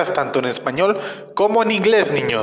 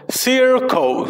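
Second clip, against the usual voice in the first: "circle" stretched out, higher pitched; drawn-out pronunciation model; 5 semitones higher than usual. Shown as a demonstration. Teacher modelling the English word "circle" for children, slowly drawn out. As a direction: draw out "circle" for learners to follow. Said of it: "la forma de pronunciar".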